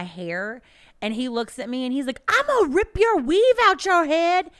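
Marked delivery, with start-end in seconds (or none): 2.3-4.5 much higher, louder mock threat; angry kid. Kid threatening the teacher, hammed-up higher yell. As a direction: play the furious little boy.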